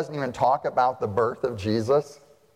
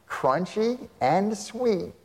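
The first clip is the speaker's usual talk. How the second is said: very slow, higher relish; slow savoring; 7 semitones higher, much slower than usual. Retold or imitated. Preacher joking about locusts and honey, slow savoring. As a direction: savor each word slowly. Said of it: "I always want to say this".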